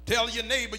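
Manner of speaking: normal talking voice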